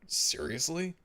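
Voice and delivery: high, slow incredulous rise; baffled realization; disbelief. Real, not acted. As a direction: stretch seriously into a stunned question. Realizing a language difference.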